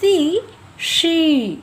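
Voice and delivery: सी with s, then शी with sh, slow and clear; teacher sets सी against शी, slow; s versus sh. Shown as a demonstration. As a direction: contrast सी and शी slowly, sounds apart. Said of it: "समझ आ रहा है ना आवाज़".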